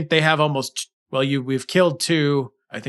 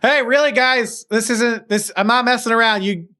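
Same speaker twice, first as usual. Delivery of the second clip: raised voice calling over; 7 semitones higher than usual. Acted. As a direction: call them back, half laughing. NPC calling the party out.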